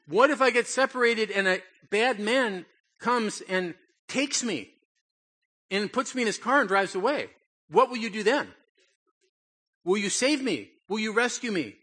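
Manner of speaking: put-on high, loud frightened child pleading; panicky little boy. Little boy begging his dad downtown, high and frantic. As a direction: beg like a scared seven-year-old who can't be left behind. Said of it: "I said".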